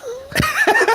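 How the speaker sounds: high eerie cackle; creepy laugh. Pretend. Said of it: "to do my creepy voice".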